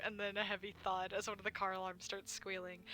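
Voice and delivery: ordinary talk, nothing marked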